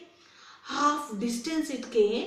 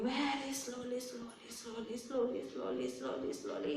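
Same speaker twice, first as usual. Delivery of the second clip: repeated word dying away to very soft; fading plod; 11 dB quieter than usual. Acted. Teacher explains the tortoise's heavy shell, plodding chant fading very quiet. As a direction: let each step shrink to almost nothing.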